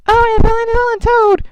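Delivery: mocking your old Yoshi voice; imitating Yoshi. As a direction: squeak it out like a little plush toy. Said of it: "at first he talks like this".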